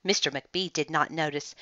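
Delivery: ordinary delivery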